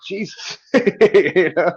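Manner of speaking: retold yelp breaking into laughter; laughing mock exclamation. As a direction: exclaim it, then laugh through the words. Laughing over retold hair-pulling pain.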